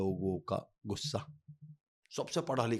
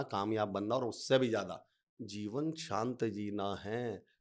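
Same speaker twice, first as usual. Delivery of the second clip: ordinary talk, nothing marked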